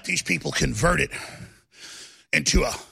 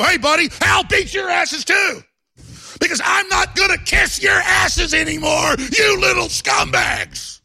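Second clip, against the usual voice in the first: loud, high, slow threat; shouted threat; 12 semitones higher, 6 dB louder, slower than usual. Retold or imitated. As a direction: yell the threat, drawing it out. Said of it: "A couple other guys go".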